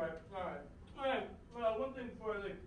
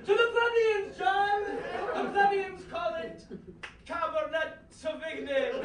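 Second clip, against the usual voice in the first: lofty posh retort; 4 semitones higher, 5 dB louder than usual. Acted. Posh guest loftily retorts.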